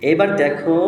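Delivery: ordinary delivery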